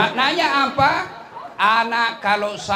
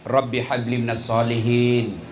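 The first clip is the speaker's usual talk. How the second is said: low, hushed Arabic prayer; whispered blessing while stroking the head; 11 semitones lower than usual. Acted. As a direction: breathe the prayer over the child. Prayer while dressing child.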